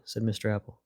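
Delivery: plain reading voice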